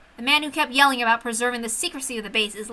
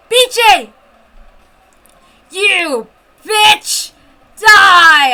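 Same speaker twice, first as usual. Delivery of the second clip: loud, much higher enraged shriek; furious scream; 7 semitones higher, 12 dB louder, much slower than usual. Acted. Kicked officer screaming at the woman, furious loud shriek. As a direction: scream it with murderous rage. Yamamoto enraged after kick.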